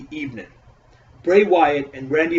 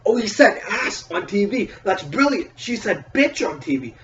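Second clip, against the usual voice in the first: mocking fake awe; 7 semitones higher than usual. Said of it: "where we're like".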